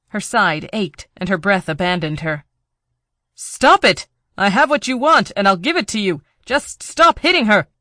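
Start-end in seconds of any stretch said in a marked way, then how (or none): 3.3-7.7 high, loud pleading shout; desperate shout. Acted. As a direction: scream at him to stop. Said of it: "Hart shouted".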